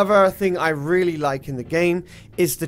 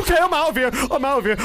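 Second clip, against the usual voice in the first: scared; 9 semitones higher, 4 dB louder, much faster than usual. Real, not acted. Fleeing ghost in game.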